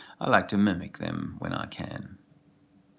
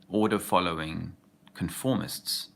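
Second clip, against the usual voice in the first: normal talking voice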